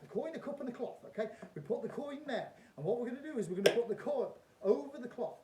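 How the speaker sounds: loud, high showman patter; kids party magician. Acted. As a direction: play it big for the crowd. Repeats magician performance.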